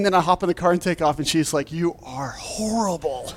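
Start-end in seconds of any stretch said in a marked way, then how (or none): 1.7-3.3 softer, slow scolding from the wife; wife's dry scold. Retold or imitated. Wife in the car scolding her prankster husband, softer and slow. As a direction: slowly scold him, half-amused.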